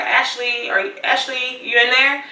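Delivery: a friend knocking and calling out, a little worried; roommate calling through door. Retold or imitated. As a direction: roommate calls to her through the locked door, concerned.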